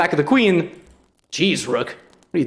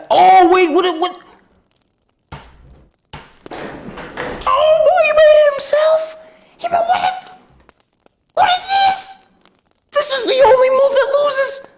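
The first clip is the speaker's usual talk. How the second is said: real very high screams of shock; aghast, pitch sky-high; shrieking disbelief; 4 dB louder than usual. Real, not acted. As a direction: squeal in hysterical astonishment. Shrieking at a losing move.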